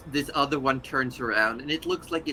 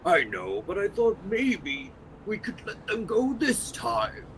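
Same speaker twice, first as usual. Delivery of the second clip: scolding creature voice; 6 semitones higher than usual. Acted. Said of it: "in Abyssal goes".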